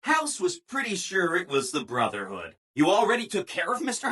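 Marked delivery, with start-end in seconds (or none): none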